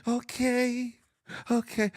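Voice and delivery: high, trembling imitation of the pastor. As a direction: push the voice way up, trembling. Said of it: "His trembling voice went ten octaves high".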